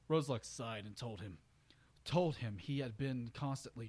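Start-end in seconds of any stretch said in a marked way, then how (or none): none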